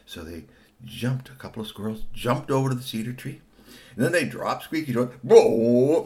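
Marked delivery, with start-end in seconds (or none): 5.2-6.0 loud, drawn-out "boom"; dropping sound effect. Acted. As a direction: boom it out as a cartoon drop noise. Imitates toy being dropped.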